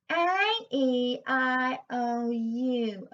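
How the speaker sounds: slow vowels pushed to the front of the face behind a cheesy grin; bright grin-shaped vowels, not pretty; forward mask resonance. Shown as a demonstration. Voice coach showing mask placement. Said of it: "sending that sound through this mask".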